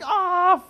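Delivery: loud, high mock scream of fright; acted-out yell of panic; freaked-out scream. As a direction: scream like a startled priest. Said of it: "freaking out".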